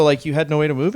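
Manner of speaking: ordinary talk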